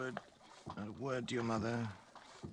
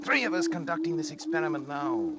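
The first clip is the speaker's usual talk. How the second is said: much higher, louder, exultant; triumphant; 6 dB louder, slower than usual. Acted. Doctor gloating to colleague over living brain, exultant. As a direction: crow over the discovery.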